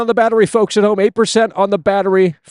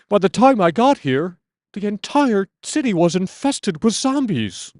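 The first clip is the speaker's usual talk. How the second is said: game hero voice; 4 semitones higher, slower than usual. Retold or imitated. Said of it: "these Resident Evil fans".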